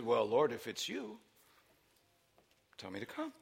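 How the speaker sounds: low, very soft tentative request; Peter. Acted. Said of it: "And then Peter says".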